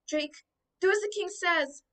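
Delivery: high, loud call after him; mother calling to her son riding away. A narrator's reading. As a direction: call out across the distance. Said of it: "his mother called out".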